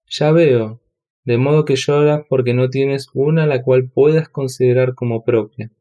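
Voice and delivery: ordinary delivery, nothing marked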